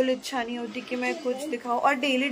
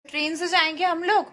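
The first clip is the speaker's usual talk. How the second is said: put-on higher, louder child-directed teasing; 7 semitones higher, 6 dB louder than usual. Mother telling her son he stays at Nanu's, teasing. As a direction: tease the little one in a raised, lifted voice.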